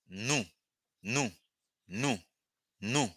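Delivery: "Nous" said again and again with a rounded oo; repeated drill. Shown as a demonstration. Teacher having the class repeat "Nous". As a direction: drill "Nous" steadily, one clean oo each time. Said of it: "it's pronounced nous".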